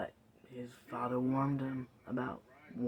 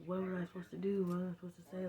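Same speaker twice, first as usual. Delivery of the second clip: put-on higher, hurried plea of a kid in trouble; pleading kid; 6 semitones higher, faster than usual. Kid pleads his case to the dean, higher and pleading. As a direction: protest his innocence. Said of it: "pleading his case".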